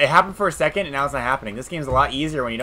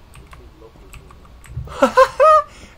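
loud laugh; 9 semitones higher, 10 dB louder than usual. Real, not acted. Laughs at audio glitch.

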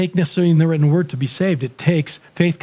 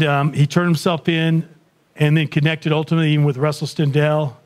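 ordinary delivery

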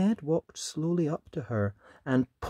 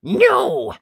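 high, loud no; prickly refusal; 10 dB louder than usual. A narrator's reading. Prickly boy answering his dad, loud high refusal. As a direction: snap out a stubborn no. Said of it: "felt all prickly, and so he answered".

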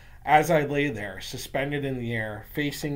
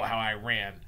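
ordinary delivery, nothing marked

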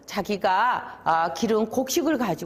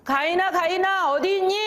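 high, drawn calling voice; 9 semitones higher than usual. Acted. God calling out to find Cain, raised-pitch summons. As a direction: call for someone you are looking for. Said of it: "가인을 부르셨어요".

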